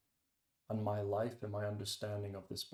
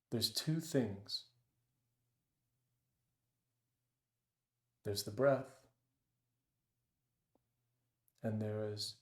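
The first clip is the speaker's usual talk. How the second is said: guided meditation. Acted. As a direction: ease them into the breath, unhurried. Leads a meditation.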